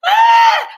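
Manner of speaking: high loud delighted shriek; excited shriek. Real, not acted. Reacting to her friend's story, excited shriek. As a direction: let out a sudden squeal.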